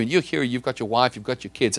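ordinary delivery

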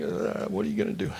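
high, soft mock-nervous voice; timid mock demons. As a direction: squeak it softly like cowed demons. Acting out demons confronting Jesus.

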